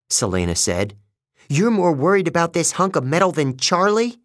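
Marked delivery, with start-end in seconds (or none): 1.5-4.2 higher-pitched indignant question; incredulous accusation. A narrator's reading. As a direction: challenge her priorities in disbelief. Selena accuses Annabeth, outraged.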